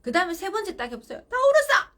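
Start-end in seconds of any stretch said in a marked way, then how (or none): 1.2-1.9 very loud, high-pitched outburst; imitated child's yell. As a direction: mimic a crying child yelling back at mum. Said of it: "이렇게 말해요".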